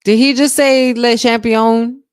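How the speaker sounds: loud, slow, high disbelief; can't believe he said that; shocked outburst. Real, not acted. Reacting to announcer.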